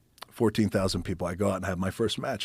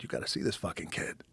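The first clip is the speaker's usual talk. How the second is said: hushed confiding; 3 semitones lower, 9 dB quieter than usual. Retold or imitated. Pat confiding to Vince.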